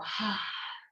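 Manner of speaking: audible breathy release; letting-go sigh. Shown as a demonstration. Yoga teacher releasing a held breath with students, audible sigh. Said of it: "And let it go".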